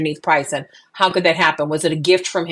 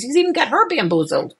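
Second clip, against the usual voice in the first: normal talking voice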